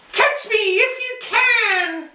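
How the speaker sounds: high, gleeful little cookie voice, slowing at the end; sing-song taunt of a runaway cookie; gingerbread baby voice. Acted. Cookie taunting Matty's family, high gleeful chant.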